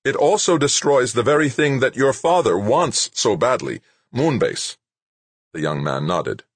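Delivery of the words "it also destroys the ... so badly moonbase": high, loud angry voice; angry Rashid. A narrator's reading. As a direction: hammer the point home. Rashid continuing angrily.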